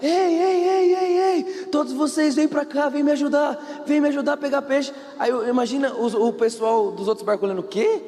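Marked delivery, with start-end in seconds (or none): 0.0-4.9 high-pitched mock shout for help; acted calling out. Peter calls the other fishermen to help, acted high-pitched shouting. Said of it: "E começa a gritar". 7.6-8.0 high-pitched incredulous question; acted disbelief. Rival fishermen hear Peter calling them, acted high-pitched disbelief.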